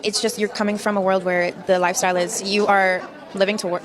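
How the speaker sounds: ordinary talk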